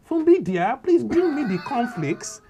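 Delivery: high, loud and slow posh Nigerian-English voice; mock posh Nigerian mum, drawn out; posh Nigerian accent. Comedian mocking the posh Nigerian TV mum accent. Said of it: "that posh Gen-, Nigerian accent".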